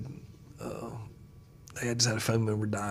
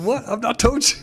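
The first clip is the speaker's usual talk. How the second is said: higher, mock innocent "What?"; playful mock surprise; 11 semitones higher, 8 dB louder than usual. Pastor teasing his stunned coworker, playful and higher. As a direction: tease him as if it were obvious. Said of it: "I said".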